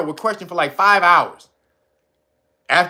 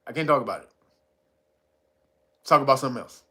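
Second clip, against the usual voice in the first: cagey dad; 4 semitones lower, 4 dB quieter, much faster than usual. Retold or imitated. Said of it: "He was like".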